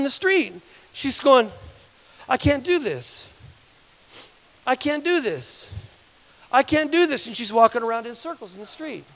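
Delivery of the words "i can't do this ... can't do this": slow, high, amazed repetition; acted disbelief. Woman walking out of her wheelchair, acted disbelief. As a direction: imitate stunned disbelief, drawn out and high.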